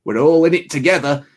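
mild imitation of an old man's hot take; old guy. Old guy at the barber's lecturing the lads, lightly imitated. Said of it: "the old guy will say".

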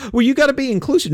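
high, fast mocking whine; sneering impression of a whining critic; whiny mock. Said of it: "people whine and complain and say".

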